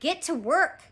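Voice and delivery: lightly voiced bossy pirate captain; pirate captain's order.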